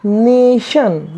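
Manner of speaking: very slowly drawn-out nation; nation said slowly, "nay-shun" with each sound held; slow model word. Shown as a demonstration. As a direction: stretch nation out with a clear श. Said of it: "क्या बोलेंगे?".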